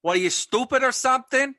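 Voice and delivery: high mock Boston outburst; hammed-up Boston tough guy; Boston accent. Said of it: "wicked cool Boston stereotypes".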